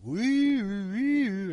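high, drawn-out "wee"; mock gleeful squeal. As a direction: squeal it like going down a slide. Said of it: "You're just like".

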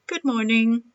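lifted, sunny greeting; friendly greeting. A narrator's reading. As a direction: sing out a cheerful hello. Grumpy woman finally greets neighbours.

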